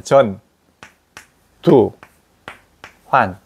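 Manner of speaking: 전, 두, 환 split into three separate beats; syllable by syllable. Retold or imitated. Teacher imitates a Gyeongsang speaker splitting 전두환. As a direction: say 전 두 환 one syllable at a time. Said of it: "경상도 사람이 전두환".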